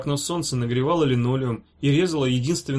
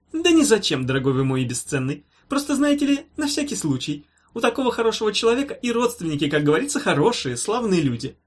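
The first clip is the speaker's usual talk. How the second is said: high, breezy doctor patter; over-sweet pompous reassurance; evasive professor; 6 semitones higher than usual. A narrator's reading. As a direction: brush off the worry airily. Professor evasively soothing the patient.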